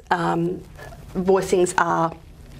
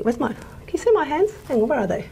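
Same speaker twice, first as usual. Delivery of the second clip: normal talking voice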